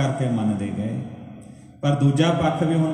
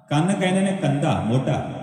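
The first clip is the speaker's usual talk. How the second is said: usual speaking voice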